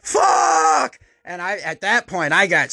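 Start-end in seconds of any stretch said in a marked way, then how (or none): 0.0-1.0 pained shout. Retold or imitated. And he was just going.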